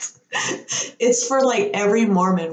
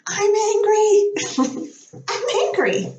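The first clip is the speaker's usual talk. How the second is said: Amy Adams; slower than usual. Retold or imitated. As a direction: squeal it like a princess discovering anger. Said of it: "realizing she's super angry about things".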